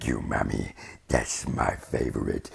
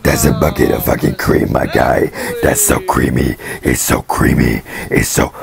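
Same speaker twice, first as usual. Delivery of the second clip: loud, fast comic yell; loud mock outburst; 2 semitones higher, 12 dB louder than usual. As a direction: shout it, fast and much louder. Loud outburst about cream.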